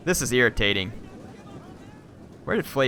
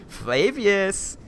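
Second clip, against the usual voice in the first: slow, higher robotic assassin-droid voice; a hint of a cold droid mocking the dead; HK-47 impression; 8 semitones higher, much slower than usual. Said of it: "my little H K accent there".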